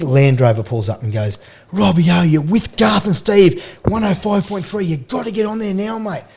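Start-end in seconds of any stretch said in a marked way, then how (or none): 1.7-6.2 higher-pitched urgent hail from a radio host; excited calling out. Retold or imitated. Radio man hailing a player from his Land Rover, excited. Radio crew calls out from car.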